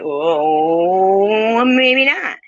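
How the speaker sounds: a long, rising "oh" climbing high; mock reconsidering. Host pretends second thoughts about recording, drawn-out rising hesitation. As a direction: draw out the oh, climbing in hammed-up hesitation. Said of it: "tempted to be like".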